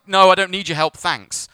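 mimicked loud brush-off, dropping on the last word; dismissive refusal. Driver turns down help, imitated dismissive refusal.

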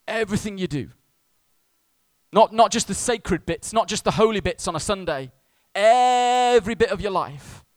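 loud, high preacher's cry; impassioned preaching. Real, not acted. Preacher demanding all of the congregation's life, full-force preaching. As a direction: hammer it home, stretching the key word.

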